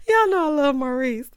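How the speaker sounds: high and loud, straight out of laughter; amused, laughter in the voice; tickled. Real, not acted. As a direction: say it while recovering from a laugh. Talking on out of laughing fit.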